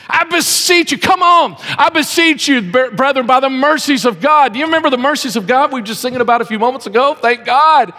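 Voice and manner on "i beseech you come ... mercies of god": "beseech" stretched, pleading and rising in pitch; "I beseech you" built up higher each time, earnest plea; beseech with gravity. Shown as a demonstration. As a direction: lean hard on "beseech", imploring. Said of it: "sounds like it's got a little more gravity".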